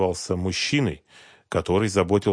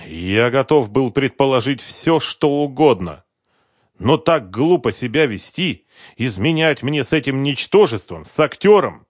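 bitter betrayed husband; 6 semitones higher, 8 dB louder than usual. A narrator's reading. Husband lamenting his wife's affair, bitter. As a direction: dwell on the insult, voice raised.

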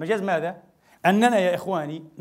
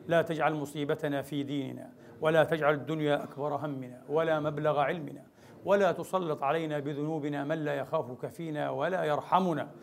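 lower, steady supplication; closing prayer; 4 semitones lower, 4 dB quieter than usual. Real, not acted. Preacher leading the congregation in closing prayer, lower and steady. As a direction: pray it humbly and evenly.

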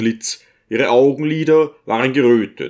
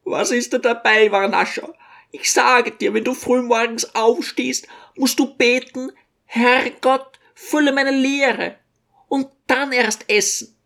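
put-on high, fussing old mother, much higher; a fretting little old woman doting on her son; old mother's voice; 12 semitones higher than usual. A narrator's reading. Old mother fussing over her son to the guests, high-pitched.

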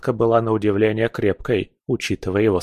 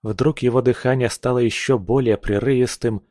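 ordinary delivery, nothing marked

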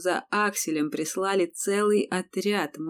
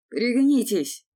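loud, high warning shout; 5 semitones higher, 6 dB louder, slower than usual. A narrator's reading. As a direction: yell for them to get down. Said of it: "крикнул Аксель".

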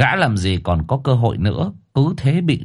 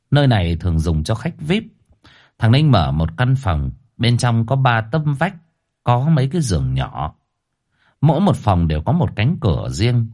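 plain reading voice

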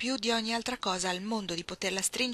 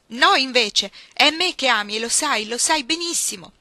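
loud, raised, insistent accusation; heated contradiction; 5 semitones higher, 11 dB louder than usual. Acted. Iggy to Ruth denying her love, heated insistence. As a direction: overrule her with angry certainty.

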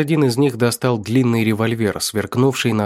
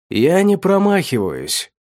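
high, slow smirking boast; 7 semitones higher, slower than usual. Acted. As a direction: gloat it with a grin. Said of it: "ухмыльнулся рыжеволосый мужчина".

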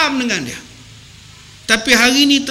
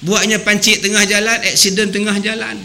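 ordinary talk

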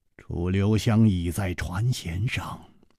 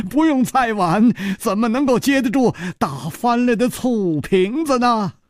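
teasing laugh; 11 semitones higher than usual. Acted. Teasing giggling girl.

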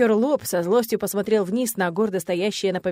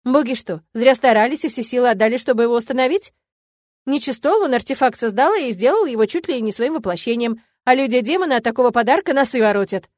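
heated argument; 3 semitones higher, 7 dB louder than usual. A narrator's reading. Friend argues in support.

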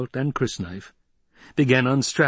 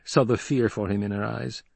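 usual speaking voice

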